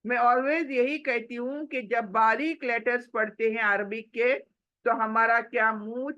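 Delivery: usual speaking voice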